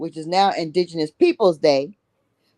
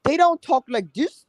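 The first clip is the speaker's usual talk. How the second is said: higher, put-on proper voice mocking newcomers; mock the way outsiders talk, pitched up; 6 semitones higher than usual. Shown as a demonstration. Host mocks how newcomers talk, higher put-on voice. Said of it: "they have dropped they mamas".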